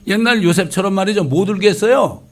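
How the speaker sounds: loud, high preaching at full force; fiery preaching. Acted. Preacher rebuking today's churches, loud and high.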